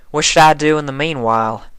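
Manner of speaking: a tough farm pony voice; plain-spoken Applejack voice; country farm mare. Acted.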